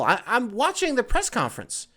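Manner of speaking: ordinary talk, nothing marked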